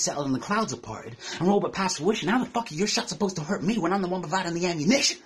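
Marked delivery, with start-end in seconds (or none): none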